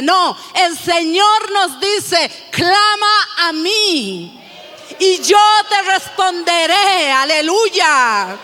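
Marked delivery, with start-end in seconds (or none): 2.5-7.1 proclaiming. Acted. Preacher proclaims God's promise to the church, slow and rising. 7.1-8.4 hallelujah. Real, not acted. Preacher exclaims praise.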